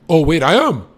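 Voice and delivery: high, loud gotcha; spring the punchline loud. Acted. Boasting to his viewers, a loud high punchline.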